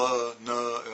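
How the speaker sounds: "l" and "n" each sounded as a syllable with "uh"; hard consonants. Shown as a demonstration. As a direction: demonstrate "l", "n" as hard "luh", "nuh". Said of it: "use these consonants really hard".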